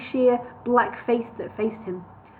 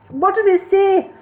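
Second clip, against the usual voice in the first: higher and louder; 10 semitones higher, 7 dB louder than usual. A narrator's reading. Bingo repeating louder to the deaf wizard. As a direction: raise your voice so he hears. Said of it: "he said more loudly".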